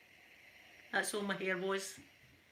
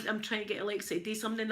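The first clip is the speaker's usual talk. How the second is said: ordinary talk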